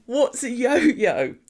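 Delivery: high, loud, slow mock bafflement; baffled. Imitating the insurance woman who didn't know the word, baffled. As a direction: draw out the puzzled question through laughter. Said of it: "She didn't even know what that was".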